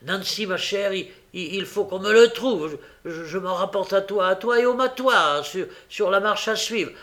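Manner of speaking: high, loud stammering orders; agitated old man. A narrator's reading. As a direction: rush the gang off in a panic.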